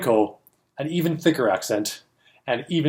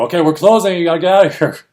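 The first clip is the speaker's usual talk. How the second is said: louder, higher call to clear the room; staff calling out; 6 semitones higher, 8 dB louder, faster than usual. Retold or imitated. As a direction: herd the crowd out. Another staff member clearing room.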